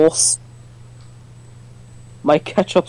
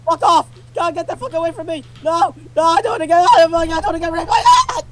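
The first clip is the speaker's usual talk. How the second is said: high, frantic screams while chased; panicked screaming; 12 semitones higher than usual. Real, not acted. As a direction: scream for your life, fast and high. Bear catches him, panicked screaming.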